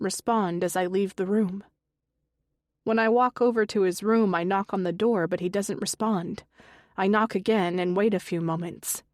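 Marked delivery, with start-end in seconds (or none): none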